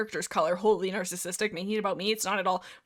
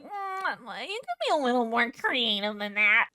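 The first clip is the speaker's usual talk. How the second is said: put-on grumbling critic voice; mock fussy grumble; nitpicking mock complaint. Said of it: "I'm like".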